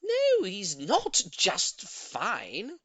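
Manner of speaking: very slow, high, loud protest; cried out. A narrator's reading. Old lady protesting to neighbour, slow loud cry. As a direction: drag out the no. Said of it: "Missus Silver cried".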